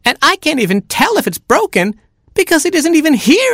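very high squeaky little chick; a tiny baby chick whining. Acted. Baby chick complaining to parents, very high squeak.

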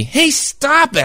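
high, slow protest; protesting kid. Acted. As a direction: push the dog away, complaining. Kid protesting dog pushed at him.

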